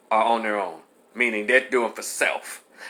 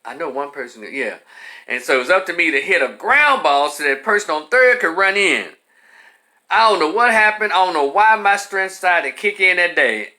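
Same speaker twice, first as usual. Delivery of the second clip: ordinary talk, nothing marked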